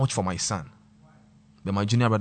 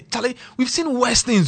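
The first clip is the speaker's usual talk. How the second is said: emphatic loud; 13 semitones higher, 8 dB louder than usual. Real, not acted. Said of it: "emphatic repeat".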